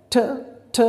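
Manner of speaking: "ठ" with a strong puff of breath; aspirated T. Shown as a demonstration. Teacher showing children the breathy T sound. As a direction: hit "ठ" with a burst of air. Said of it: "टी को ठ कर देना है".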